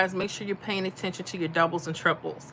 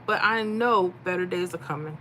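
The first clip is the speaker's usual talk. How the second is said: usual speaking voice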